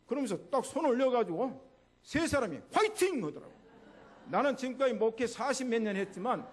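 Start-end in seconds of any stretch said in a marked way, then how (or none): 2.7-3.2 very high, loud rallying shout; team cheer. Acted. Family cheering over stacked hands, high and loud. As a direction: cheer like a team before a match.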